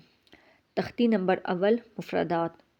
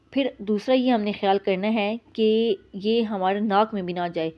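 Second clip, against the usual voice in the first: ordinary talk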